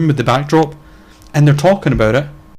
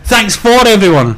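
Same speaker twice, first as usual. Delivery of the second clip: high, loud announcer sign-off; sign-off line; 8 semitones higher, 5 dB louder than usual. Shown as a demonstration. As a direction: proclaim it like closing a show. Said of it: "Remember our sign off".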